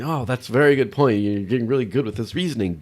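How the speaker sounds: mock chatbot, high and loud, praising; butter up the user while holding firm; flattering Grok. Grok praising the user yet refusing to budge, mock flattering. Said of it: "Grok kept on saying".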